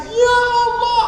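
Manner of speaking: high, wailing cry to God; mock weeping. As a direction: imitate overwhelmed pilgrims crying out. Acts out pilgrims weeping at the Kaaba.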